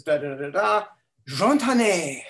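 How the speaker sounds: Jontane in a mock French accent, the two words run together; French-sounding Klingon. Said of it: "like that".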